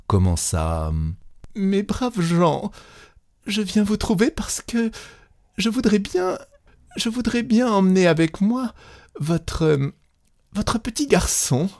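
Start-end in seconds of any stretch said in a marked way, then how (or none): 1.6-11.7 put-on a nervous young wife begging, words breaking; broken trembling voice. A narrator's reading. Young wife asks peasants for their boy, trembling and halting. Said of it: "d'une voix entrecoupée, tremblante".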